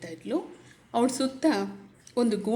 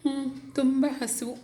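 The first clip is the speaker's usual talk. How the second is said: lightly voiced small girl, higher and slow; a hungry child's small reply, drawn out; a hint of a tired little girl; 5 semitones higher, slower than usual. A narrator's reading. Little girl answers her uncle.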